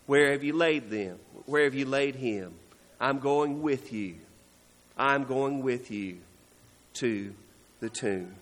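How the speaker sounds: low, soft, measured words to the grieving; tender, solemn. Acted. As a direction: gently offer to share their sorrow, pausing. Voices Jesus tenderly joining the grieving.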